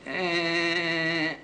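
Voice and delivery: high, noncommittal shrug of a sound; a so-so verdict, half impressed; half-hearted meh. Shown as a demonstration. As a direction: lukewarm eh. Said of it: "what do you say when you half like a film".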